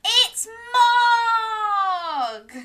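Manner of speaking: high, loud delighted shout; excited cry. Acted. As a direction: cry out in happy surprise. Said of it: "Debbie cried".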